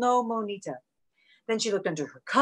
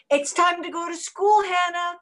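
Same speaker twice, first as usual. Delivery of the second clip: put-on higher sing-out to a child; mom calling out; 6 semitones higher, 3 dB louder than usual. A narrator's reading. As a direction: call upstairs like a mom, pitched up. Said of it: "called her mom".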